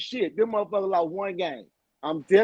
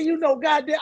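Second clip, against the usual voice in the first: retold locker-room rant; 6 semitones higher than usual. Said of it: "I say".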